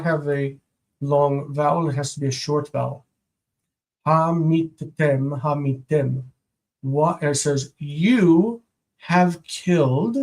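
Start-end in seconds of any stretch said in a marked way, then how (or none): 4.1-6.2 Ha, meet, tem, each syllable apart, slow; slow syllable-by-syllable demo. Shown as a demonstration. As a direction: break the word into its syllables. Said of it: "Stress is here".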